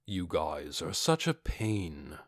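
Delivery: low, slow, soft weary grumble; an unbothered warrior sighing at pests; Ainz, bored. Acted. Ainz annoyed.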